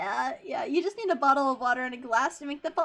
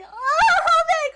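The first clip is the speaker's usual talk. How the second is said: very high, loud shriek of a name; delighted surprise; 11 dB louder, much slower than usual. Real, not acted. Streamer thrilled at Homic's gift, high and loud.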